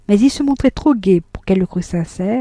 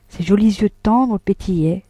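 plain reading voice

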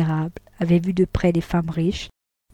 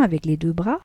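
ordinary delivery

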